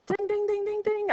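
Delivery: high playful bell dings. Pretend. Teacher celebrates common denominators with mock bell dings. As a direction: ring it like a prize bell. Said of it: "I have common denominators".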